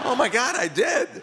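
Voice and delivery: high-pitched, amused disbelief; astonished realization. Retold or imitated. Actor finding a forgotten credit online, amused astonishment. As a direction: act out shocked discovery, voice jumping up.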